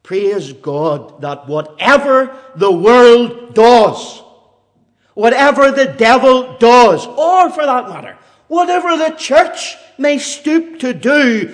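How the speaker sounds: loud, slow triumphant preaching. Acted. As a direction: declare it over the congregation. Preaching at full force.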